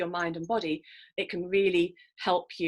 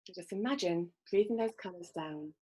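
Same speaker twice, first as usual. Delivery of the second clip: guided meditation; 6 dB quieter than usual. Acted. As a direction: gently guide listeners into calm. Leads a breathing visualization.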